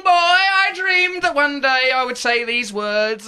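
mock-solemn build to the guest's name; hammed-up ceremonial introduction; grand announcement.

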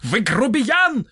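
high, drawn-out indignant cry; outraged accusation in a high voice; shouting. A narrator's reading. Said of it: "закричал Толстый". (возмущённый крик высоким голосом)

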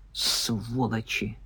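slow, low hiss; very quiet, drawn-out contempt; hissed curse. A narrator's reading. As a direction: hiss it softly with venom. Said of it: "прошипел парень".

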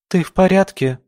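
put-on high, anxious child voice; worried little girl. A narrator's reading. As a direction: ask like a scared little girl. Frightened girl asks. (испуганный детский голосок)

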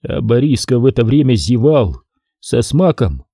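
usual speaking voice

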